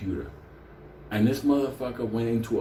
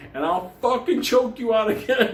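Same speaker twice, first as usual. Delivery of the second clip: higher and louder threat; cocky threat; 9 semitones higher, 4 dB louder than usual. Retold or imitated. Benny taunting Jia Wei's father, higher and louder. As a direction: taunt him with a grin. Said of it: "Benny just said".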